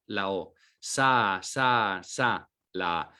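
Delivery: sa drawn out slowly and repeated; slow syllable demo. Shown as a demonstration. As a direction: stretch sa for the child to hear. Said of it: "a segmentar la palabra rosa".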